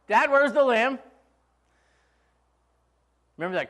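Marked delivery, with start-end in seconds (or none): 0.1-1.0 anxious son Isaac. Retold or imitated. Isaac asks about the lamb.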